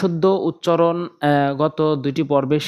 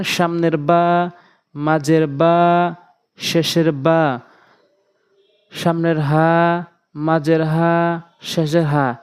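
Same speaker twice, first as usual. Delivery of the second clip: slow teaching; much slower than usual. Shown as a demonstration. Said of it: "slow teaching of letter positions".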